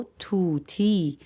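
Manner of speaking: طوطی slowly as "thoo-thee", breath after each t; Persian aspirated t. Shown as a demonstration. Teacher repeats the parrot word for learners, slow aspirated pronunciation. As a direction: draw out طوطی with aspirated t sounds. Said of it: "طا کے ساتھ ہا کی آواز آتی ہے".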